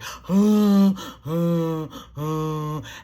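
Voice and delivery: gasp. Retold or imitated. Mock gasp of amazement.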